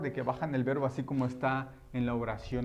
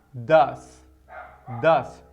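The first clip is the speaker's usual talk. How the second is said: "does" punched much louder, on its own; emphatic does; 11 dB louder than usual. Shown as a demonstration. Teacher stressing the auxiliary for students, loud and emphatic. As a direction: hit "does" hard as the key word. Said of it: "En este caso utilizamos".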